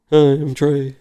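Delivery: imitating yourself as the clueless new guy; mock-cheery greeting. Retold awkward greeting to hostile strangers.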